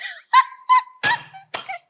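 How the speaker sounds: high-pitched burst of laughter. Acted. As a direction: let out a sudden high laugh. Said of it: "And why are you laughing?".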